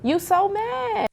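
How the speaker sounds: high, drawn-out taunt; stretched, high-pitched jab. Real, not acted. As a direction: needle them slowly in a high voice. Taunting the other party in quarrel.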